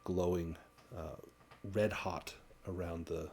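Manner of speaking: game master's narration. A narrator's reading. Game master describing the sealed jar to players, hushed measured narration. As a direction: narrate solemnly, letting phrases fall away quietly.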